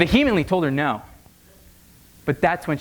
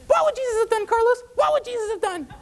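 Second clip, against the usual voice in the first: very high shrieking mother voice; hysterical mom. Retold or imitated. Frantic mother on the phone to her drunk son, very high.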